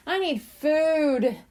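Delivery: high, slow whiny kitten; hungry exclaim. A narrator's reading. Angel at dinner time, slow and whiny. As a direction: drag it out, starving. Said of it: "Angel exclaimed".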